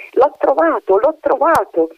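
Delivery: high, excited cry of discovery; crying out with joy. A narrator's reading. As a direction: shout it out like a child who found the treasure. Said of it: "si mise a gridare".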